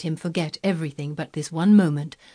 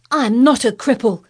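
put-on high, loud boyish outrage; outraged child, voice raised; furious cry; 6 semitones higher, 8 dB louder than usual. A narrator's reading. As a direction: cry out in a fury. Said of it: "he cried out furiously".

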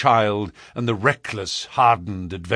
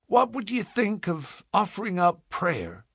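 tentative, raised-pitch suggestion; hesitant frontiersman, a little softer; diffident; 9 semitones higher, 4 dB quieter than usual. A narrator's reading. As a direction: float the idea shyly. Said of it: "the man asked diffidently".

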